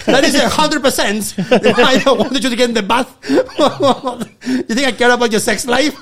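high, emphatic, breaking into laughter; laughing admission. Retold or imitated. Comedian admitting to hurt friend why he said bath, laughing. Retold gleeful confession to friend.

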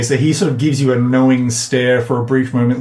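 normal talking voice

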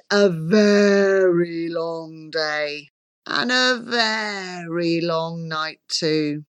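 slow, fading, stretched "very long"; drawn out. A narrator's reading. Narrator tells of an endless Christmas Eve, drawn out.

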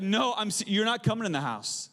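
wife blocking the door, hammed-up and laughing; mock stern wife. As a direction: play the wife barring the door, half-joking. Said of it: "she's like".

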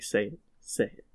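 low, soft imitation of someone waiting; hushed, mock-expectant prompting; imagined silent urging. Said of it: "He's just silently waiting, like".

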